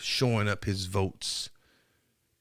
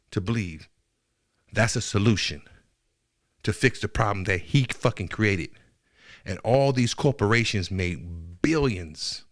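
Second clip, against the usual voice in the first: ordinary talk, nothing marked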